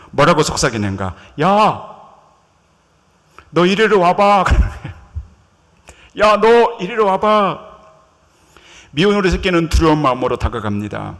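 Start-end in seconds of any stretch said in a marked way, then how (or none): 1.4-4.4 loud call to come over. Acted. Swan calls out to the ugly duckling, loud summons. 6.2-7.7 loud, slow, drawn-out call to come; slow summons. Acted. Swan beckons the duckling a second time, slow loud call.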